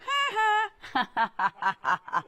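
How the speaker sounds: high, mocking triumphant laugh; gloating ha ha. Host looking back at old teachers, gloating laugh. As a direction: crow a teasing ha ha back at them. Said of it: "now I can look back and go".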